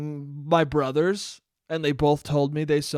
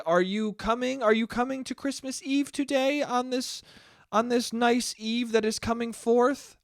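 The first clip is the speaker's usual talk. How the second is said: higher, overly formal rambling; mock mom; 7 semitones higher than usual. Son mocking his mom's wordy texts, higher pitch. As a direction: read it like a confused formal mother. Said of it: "you wanna read the text".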